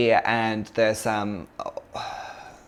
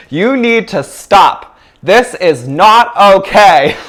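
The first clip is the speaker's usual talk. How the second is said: louder, higher and slow, every word set down; firm command shout; 8 semitones higher, 11 dB louder, slower than usual. Retold or imitated. Blocking the men from the girl, slow loud commanding shout. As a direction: plant your feet and order them to stop. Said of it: "one of those cartoon characters".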